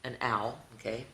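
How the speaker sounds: ordinary delivery, nothing marked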